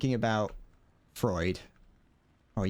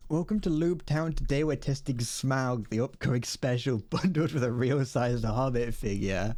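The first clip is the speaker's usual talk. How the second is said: mock spokesperson; 4 semitones higher than usual. Streamer pretending to advertise an upcoming special, hammed-up spokesperson. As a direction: pitch it like a product spokesperson. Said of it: "I would be the best spokesperson".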